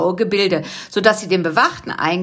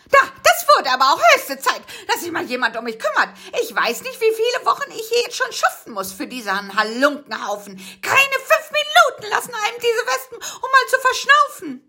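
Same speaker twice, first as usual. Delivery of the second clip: much higher, cackling complaint with laughs; whining old aunt. Acted. The aunt complains to her rescuers, high and whiny. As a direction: grumble like a put-upon old cook.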